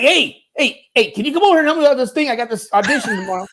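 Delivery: high, rushed imitation of a friend; eager mock plea. Friend phones asking for help.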